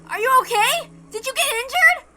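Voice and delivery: a friend desperate to know she is alive; panicked shout. Acted.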